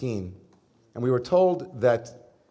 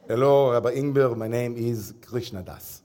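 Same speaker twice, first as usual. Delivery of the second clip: put-on Israeli accent. Rabbi mimicking [name] greeting him, light Israeli accent. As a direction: imitate an Israeli teacher naming himself, in an Israeli accent. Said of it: "I'm someone who's good at accents".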